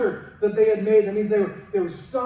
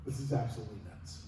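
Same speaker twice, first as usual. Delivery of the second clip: much lower and softer, muttered under the breath; muttered disbelief; 12 semitones lower, 15 dB quieter than usual. Retold or imitated. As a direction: grumble in disbelief to yourself. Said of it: "he's looking at this going".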